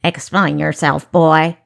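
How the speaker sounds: loud, curt command; impatient snap. A narrator's reading. Guard questioning the supposed boy, impatient snap. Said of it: "the guard snapped impatiently".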